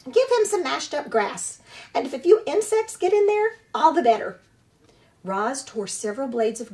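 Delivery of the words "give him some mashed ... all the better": high, loud squawking goose voice; squawking old goose. Acted. Old goose squawks at the robot about the orphaned hatchling, high and loud. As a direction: scold like an indignant old goose.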